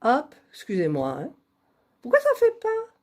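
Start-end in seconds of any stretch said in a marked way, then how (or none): 2.0-3.0 high squeaky exasperated question; high-pitched annoyed whine at the screen; squeaky frustration. Real, not acted. Frustrated at the computer.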